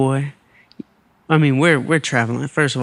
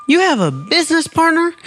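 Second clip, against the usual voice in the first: mom's voice; 12 semitones higher than usual. Retold or imitated. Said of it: "She says".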